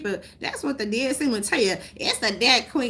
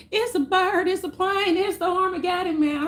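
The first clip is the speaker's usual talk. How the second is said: high, panicked mock voice; mocking the sheep; 9 semitones higher than usual. Sheep and zombies panicking, high mocking voice. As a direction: parody the alarmed crowd, higher. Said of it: "The sheep and zombies are like".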